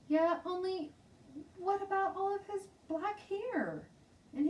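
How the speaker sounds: put-on high, eager little girl insisting; a young girl sure she is right.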